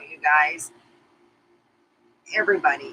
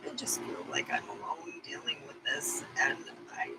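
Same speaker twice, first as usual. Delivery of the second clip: much softer, almost whispered; hushed loneliness; 3 semitones lower, 12 dB quieter than usual. Real, not acted. Telling viewers she feels alone with menopause, hushed and much softer. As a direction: let the voice sink to a breathy hush.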